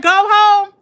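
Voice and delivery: loud, high, half-laughing; retelling the cop's dismissal, loud and laughing; retold cop, loud. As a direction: belt the line through laughter. Repeats cop, louder, laughing.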